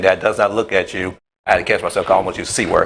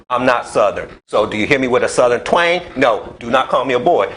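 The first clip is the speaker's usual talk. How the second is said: normal talking voice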